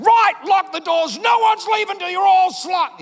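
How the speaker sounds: mock villain roaring at the wedding guests; menacing shout. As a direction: bark it like a tyrant sealing the exits. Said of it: "what Jesus wasn't saying".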